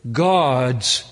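ordinary talk, nothing marked

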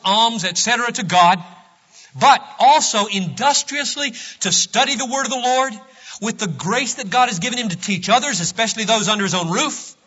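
preacher reading scripture-like; emphatic reading. Acted. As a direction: proclaim the old text with fervor. Reads a pietist quote aloud.

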